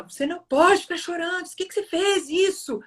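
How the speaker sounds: high-pitched retold reproach; scolding. Father shaking his son for crying, scolding. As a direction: imitate a father telling off his child. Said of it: "ele briga muito com o menino".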